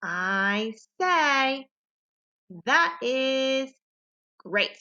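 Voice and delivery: a clear pause after "I say" before "That is great"; pause at the comma. Shown as a demonstration. Teacher shows students where the comma's pause falls. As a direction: break the phrase at the comma. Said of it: "it always shows us where the pause is".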